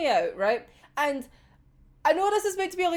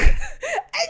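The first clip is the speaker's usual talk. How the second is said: high, loud burst of laughter; shrill laughing shriek; 14 semitones higher, 5 dB louder than usual. Real, not acted. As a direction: cackle in disbelief. Reacting to the comic.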